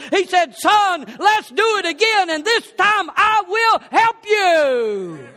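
loud, high, rising sermon climax; preaching at full force. Acted. Preacher to congregation, loud and climactic. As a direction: drive the message home at full force.

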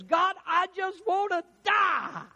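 high, slow, loud anguished wail; Elijah's despairing cry. Retold or imitated. Elijah crying out to God in despair, slow loud wail. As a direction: cry out to God like you want to die. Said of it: "he says".

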